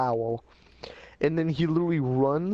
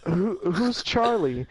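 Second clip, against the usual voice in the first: scared question; 5 semitones higher, slower than usual. Retold or imitated. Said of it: "And I'm like".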